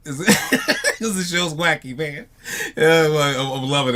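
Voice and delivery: real laugh over the words. Real, not acted. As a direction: chuckle it out, amused. Laughing about the wacky show.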